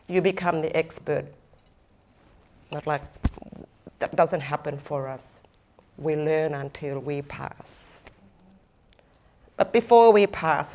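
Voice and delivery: normal talking voice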